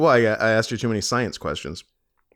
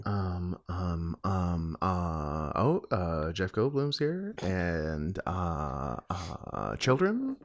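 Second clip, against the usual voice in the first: quiet stalling; 6 semitones lower, 6 dB quieter than usual. Pretend. Pretending to look around, stalling.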